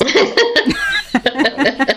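high laugh of disbelief; incredulous laugh. Real, not acted. Laughing at the doctor's four-hour deadline, high and incredulous.

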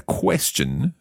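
ordinary talk

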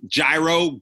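hard j on "gyro", then a long i; "gyro" pronounced JAI-ro, as one option in a list. Shown as a demonstration. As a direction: say "gyro" as JAI-ro, rhyming with Cairo. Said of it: "I say, I've said gyro".

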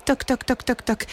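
soft, even knocking; imitated heartbeat. Narrator imitates Étincelle's heart beating harder, soft knocking. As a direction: tap it out softly like a pulse.

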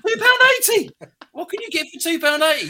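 outraged disbelief at the price; incredulous. Retold or imitated. Scoffing at the customer's offer, high loud disbelief.

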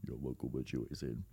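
deep, quiet London roadman voice; london roadman accent. Pretend. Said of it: "a really good Roadman impression".

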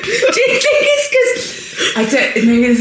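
high giggles through words; can barely speak for laughing; laughing hard. Real, not acted. Laughing hard at friend's story.

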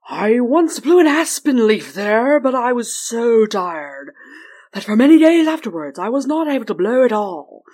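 mighty weary wind spirit; North Wind voice. Acted. North Wind speaks at length.

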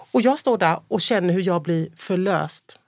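ordinary talk

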